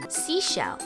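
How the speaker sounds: usual speaking voice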